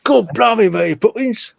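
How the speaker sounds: high hammed-up Cockney; Cockney, pushed up high; Cockney accent. Shown as a demonstration. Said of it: "that's Cockney".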